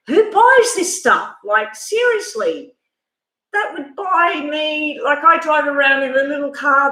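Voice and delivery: high, slow, stunned disbelief; can't believe the price, drawn out high. Retold or imitated. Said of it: "And I was just like".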